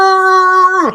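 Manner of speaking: high loud muffled brrr; garbled yell while spinning; muffled spinning head. Retold or imitated. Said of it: "you just hear him just muffled".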